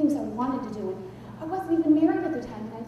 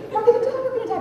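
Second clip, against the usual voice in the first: high, hammed-up wail; mock tearful shriek; crying and screaming; 11 semitones higher, 3 dB louder, much faster than usual. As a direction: reenact hysterical panic. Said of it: "I'm crying and I'm screaming".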